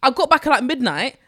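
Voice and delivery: loud, riled-up retelling of his anger; retold anger. Telling a friend about the keyed car, loud and fuming. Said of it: "Do you know how angry I was".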